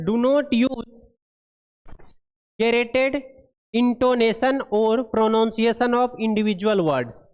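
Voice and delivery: very slow, word-by-word reading of English text; deliberate classroom read-aloud; slow dictation read. Acted. Reads English guideline aloud.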